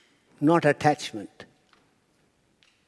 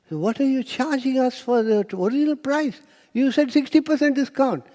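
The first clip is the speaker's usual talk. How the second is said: indignant complaint; 9 semitones higher than usual. Retold or imitated. Customers protesting the bill.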